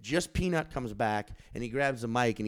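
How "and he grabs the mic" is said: usual speaking voice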